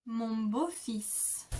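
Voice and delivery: "Mon beau-fils" said very slowly, each sound clear; drawn out so learners catch "beau-fils"; slow French demo. Shown as a demonstration. Teacher modelling the word for learners. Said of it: "you would say".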